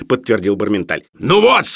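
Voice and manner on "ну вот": high, loud exclamation; thundering. A narrator's reading. Professor takes up the doctor's confirmation, thundering. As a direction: thunder it in triumph. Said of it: "гремел Филипп Филиппович".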